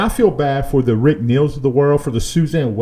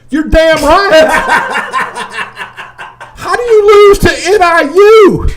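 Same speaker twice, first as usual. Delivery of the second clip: much higher, louder rant with laughs; 13 semitones higher, 9 dB louder than usual. Real, not acted. Fan ranting about his team's loss, loud and high. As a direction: erupt in exasperated disbelief. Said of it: "Oh, my gosh".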